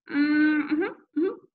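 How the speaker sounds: high hummed reply, muffled; closed-mouth mumble. A narrator's reading. Boy answering mother with stuffed mouth, mumbling. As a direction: hum twice through sealed lips. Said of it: "trying not to open my overstuffed mouth".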